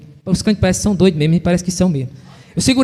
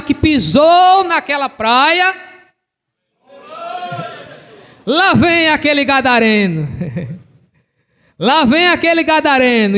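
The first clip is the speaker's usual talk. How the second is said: high-pitched, driving pulpit delivery; preaching; 6 semitones higher than usual. Real, not acted. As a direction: preach it with rising intensity. Preaches the story at full force.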